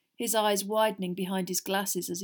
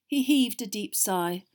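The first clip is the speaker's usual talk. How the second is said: plain reading voice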